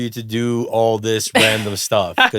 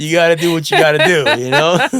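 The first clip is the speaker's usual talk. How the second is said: louder, higher imitation of a demanding immigrant parent; mock strict parent; 5 semitones higher, 7 dB louder than usual. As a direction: play the pushy parent for a laugh.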